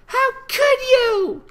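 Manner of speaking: put-on high wavering cry of a hurt young filly; child's voice on the edge of tears; a filly accusing through rising tears; tearful filly voice. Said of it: "with a wavering trill of threatening tears on her voice".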